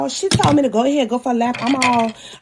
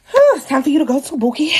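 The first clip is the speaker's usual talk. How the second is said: ordinary talk, nothing marked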